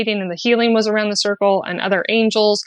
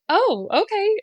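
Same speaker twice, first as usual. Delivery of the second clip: surprised oh; 11 semitones higher, slower than usual. Retold or imitated. Sheepish reply to Jesus.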